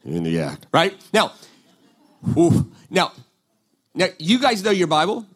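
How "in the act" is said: a sly, low aside; low knowing repeat. Real, not acted. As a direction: drop the voice way low on in the act. Repeats phrase, dropped low.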